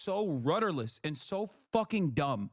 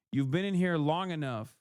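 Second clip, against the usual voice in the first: ordinary talk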